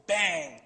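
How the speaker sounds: loud spoken bang of a slamming door. Retold or imitated. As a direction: punch out the slam. Door slam sound imitated.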